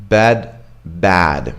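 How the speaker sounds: "Bed" with a mid eh, then "bad" with a wide open ae; "Bed, bad" spaced apart, each vowel distinct; contrasted vowels. Shown as a demonstration. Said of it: "Completely different sounds".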